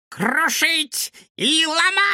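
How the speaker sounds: high, slow, shrieking gleeful cry; a villain squealing with joy; squealing with delight. Acted. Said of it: "визжал от восторга".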